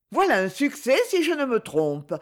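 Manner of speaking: a touch of sarcasm in the compliment; understated ironic remark. A narrator's reading. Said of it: "d'un ton ironique".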